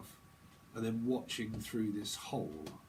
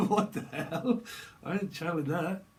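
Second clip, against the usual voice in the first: laughing disbelief; 5 semitones higher, 4 dB louder than usual. Real, not acted. Reader shocked by what's being channeled, laughing disbelief. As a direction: exclaim it while cracking up. Bursts out at own reading.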